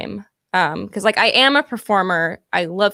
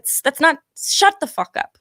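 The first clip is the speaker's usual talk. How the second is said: mock self-scolding; 6 semitones higher than usual. Scolding herself for a reference.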